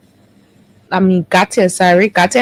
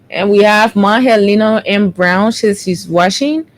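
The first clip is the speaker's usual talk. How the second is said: ordinary delivery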